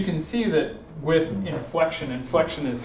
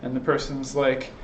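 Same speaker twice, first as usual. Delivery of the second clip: ordinary delivery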